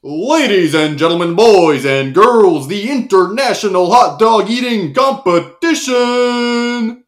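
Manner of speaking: booming arena announcer. Acted. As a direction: build to the big name. Announcer opens competition.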